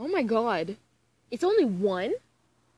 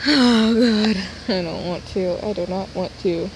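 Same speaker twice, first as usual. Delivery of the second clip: loud, dreading protest; loud groan; 11 dB louder than usual. Real, not acted. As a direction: protest loudly. Dreading the text.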